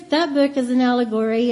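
ordinary talk

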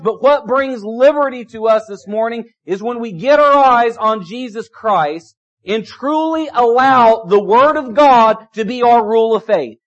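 ordinary delivery

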